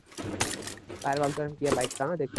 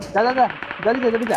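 loud, high urging; urgent shout; 6 semitones higher, 9 dB louder than usual. Real, not acted. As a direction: yell at a teammate to hurry. Urging teammate during firefight.

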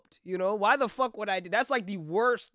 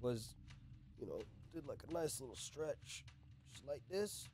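very soft, hushed smooth move; hushed demonstration of the smooth move; sly demo; 19 dB quieter than usual. Shown as a demonstration. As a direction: barely breathe it as you act out the stretch. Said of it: "pretend this chair is her".

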